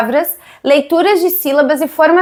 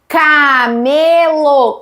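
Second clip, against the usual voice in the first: CAMELO drawn out as ca, me, lo; syllable by syllable; much slower than usual. Shown as a demonstration. Teacher showing how to count syllables, CAMELO slowly split in three. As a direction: stretch each syllable of CAMELO very slowly, evenly. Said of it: "separação de sílabas".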